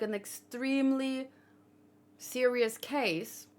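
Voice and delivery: ordinary talk, nothing marked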